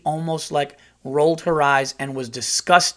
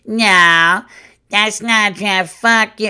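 put-on high, loud, drawn-out drunken whine; mock a loaded heckler yelling indignantly; 7 semitones higher, 9 dB louder, slower than usual. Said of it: "drink and get loaded and they hear something and they just go".

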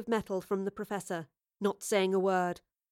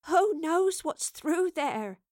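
slow, higher weary voice; tired, slow resignation; 7 semitones higher, much slower than usual. A narrator's reading. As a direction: answer wearily, drawing it out. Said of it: "Evie replied wearily".

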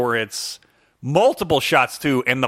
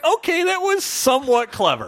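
higher, mock-impressed concession; a lightly voiced, higher okay-fine; grudging approval; 10 semitones higher than usual. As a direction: admit it, a little reluctantly. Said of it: "I'm like".

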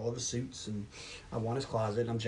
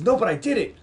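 louder, higher mock desperation; acted, raised-voice insistence; insistent protest; 6 semitones higher, 6 dB louder than usual. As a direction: push back hard to be believed. Acted insistence against lawyer's denial.

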